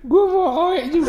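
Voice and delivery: put-on very high, laughing baby voice. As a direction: giggle it out like a baby. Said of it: "kalau lo Bar gimana". (suara bayi sambil tertawa)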